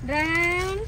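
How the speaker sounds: name called on a rising pitch; calling out. Real, not acted. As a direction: call the dog with a drawn-out, climbing lilt.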